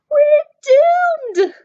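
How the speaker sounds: high, loud terrified scream. Acted. Mitzi seeing the monster shape, screaming in terror. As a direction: scream in panic. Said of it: "Mitzi screamed".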